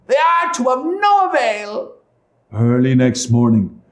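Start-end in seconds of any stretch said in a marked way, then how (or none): 0.1-1.9 very high witch voice; scornful witch. Acted.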